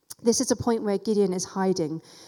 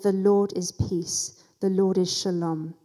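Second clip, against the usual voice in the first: slow, weighty "The Lord is Peace"; solemn naming; 2 semitones lower than usual. Acted. Gideon naming his altar, slow and solemn. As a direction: lay each word down slowly and deliberately.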